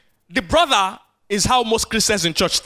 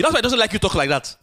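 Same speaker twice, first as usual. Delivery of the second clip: normal talking voice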